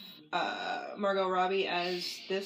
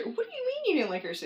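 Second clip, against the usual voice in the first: higher and fast, disbelieving question; incredulous; 10 semitones higher, faster than usual. Real, not acted. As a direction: pounce on a shocking opinion in disbelief. Friend disputes her Catwoman opinion.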